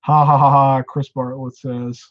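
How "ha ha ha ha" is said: loud laugh. Real, not acted. Laughing at a chat comment.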